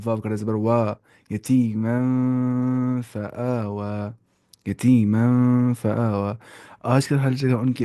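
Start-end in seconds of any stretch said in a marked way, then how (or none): 1.3-6.3 very slow "یتیمن", noon hummed into "فآوا"; nasal ikhfa. Shown as a demonstration. Teacher recites the joined words, slow nasal ikhfa. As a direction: hide the noon in the nose, stretch "فآوا". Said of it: "تو یہاں پہ اخفا کر دینا ہے".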